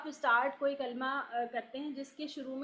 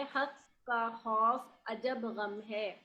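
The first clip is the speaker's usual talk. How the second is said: حق کا خوف عجب غم ہے said very slowly, word by word; slow mnemonic; much slower than usual. Shown as a demonstration. Teacher dictates the izhar mnemonic for students to memorise, slowly. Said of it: "وہی میں نے یہاں لکھ دیا ہے".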